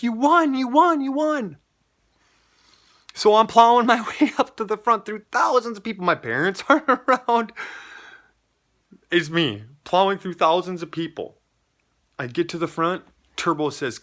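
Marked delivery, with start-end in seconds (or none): 0.0-1.6 high, loud, repeated excited cry; excited cheering. Retold or imitated. Sister tells brother he has won the draw, excitedly crying out. 3.1-11.4 high, loud, elated rush of words; excited storytelling. Real, not acted. Man retells plowing through the crowd as winner, loudly excited.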